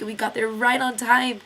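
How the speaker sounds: ordinary delivery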